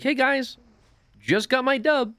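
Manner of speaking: put-on high-pitched seven-year-old bragging about a win; mock excited kid. Streamer mocking a player announcing a win, high childish voice. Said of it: "It's like my nephews who".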